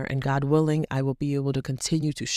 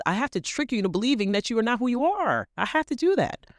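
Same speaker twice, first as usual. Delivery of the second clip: voicing the devil; 8 semitones higher than usual. Voicing the devil's scheming thoughts.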